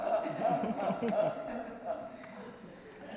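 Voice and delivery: breathy menacing villain laugh; evil laugh. Acted. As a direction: laugh darkly at the king's plight. Knight laughs after riddle.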